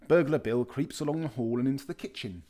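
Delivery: ordinary delivery, nothing marked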